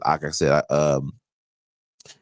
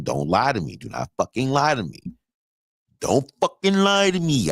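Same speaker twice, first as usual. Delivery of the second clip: building stern warning; 5 semitones higher than usual. Retold or imitated. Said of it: "I hate when".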